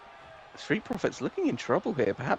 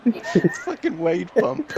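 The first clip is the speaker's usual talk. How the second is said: slow amused disbelief; 6 semitones higher, 4 dB louder, slower than usual. Real, not acted. Laughing at the name.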